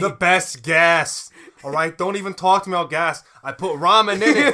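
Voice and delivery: loud, high huckster pitch; hammed-up gas station salesman; brash pitchman. Attendant boasting about his gas, loud brash pitch.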